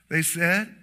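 normal talking voice

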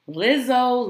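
high, loud, thrilled calling of the name; loud fan shout-out; excited call. Real, not acted. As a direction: hype her name up like a fan.

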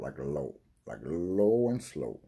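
stoned, dragging low delivery; low and slow. Real, not acted. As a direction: sink low and stretch it out. Acts out low, slow heavy high.

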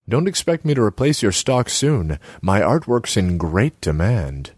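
Selma's voice. Acted. Selma needles Borislav about his empty kiosk, high voice. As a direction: pitch her up high and needling.

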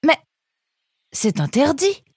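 protestation haute, scandalisée; s'indigner d'une voix aiguë; indignation choquée. A narrator's reading. Nemrod shocked. (Nemrod proteste, voix aiguë et choquée)

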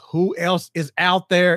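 startled, scanning for danger; alarmed. Retold or imitated. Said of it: "You're like".

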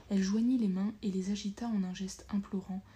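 ordinary delivery, nothing marked

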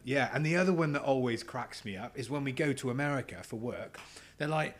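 ordinary talk

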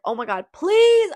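very high, loud, slowed desperate cry; panicked plea. Retold or imitated. Mom chasing his truck, terrified.

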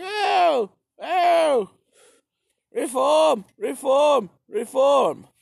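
high, loud mock empress cry; mock Catherine the Great. Narrator mocking Catherine's reforms, high and loud. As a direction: gush over reform like a cartoon queen. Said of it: "Catherine the Great was just like".

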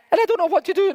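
high, hurried whine; mock little victim. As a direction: whine it quickly, playing the victim. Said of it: "I was being a little victim".

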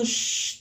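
drawn-out airy shush. Retold or imitated. Student shushes teacher in front of class, airy shush. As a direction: hush with pure breath. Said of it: "le hice así".

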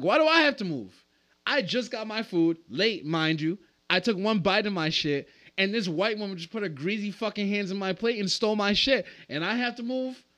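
outraged at being asked to move; indignant rant. Retold or imitated. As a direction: argue like it's unfair.

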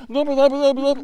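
high, loud mock Russian babble; imitating a girl suddenly speaking Russian; Russian-sounding gibberish. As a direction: rattle off fake Russian loudly. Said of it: "you sound like you have a Russian accent".

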